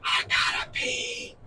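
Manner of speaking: put-on high breathy drunk whisper in his ear. To Joseph at the party, a hammed-up drunk whisper. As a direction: mom leaning into Joseph on the dance floor, tipsy whisper. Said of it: "does that hot beer whisper".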